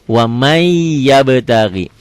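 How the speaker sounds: "Wa may yabtaghi" drawn out slowly on the Y; held idgham. Shown as a demonstration. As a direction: hold "may" long into "yabtaghi", no N. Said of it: "Wamai ditahan".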